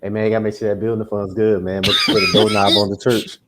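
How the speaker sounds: ordinary delivery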